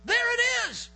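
high, loud excited shout; yelling. Retold or imitated. Partner spotting the downed turkey, retold excited yell. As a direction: yell it across the river. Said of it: "He yells".

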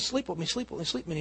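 low, soft, repeated mock come-on; mock pestering. Master's wife harassing Joseph day after day, retold as quiet pestering. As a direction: imitate persistent nagging in an undertone.

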